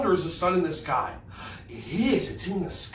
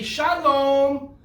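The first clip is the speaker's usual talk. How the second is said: called-out greeting; 7 semitones higher, 4 dB louder than usual. Shown as a demonstration. Leads kids calling out greeting.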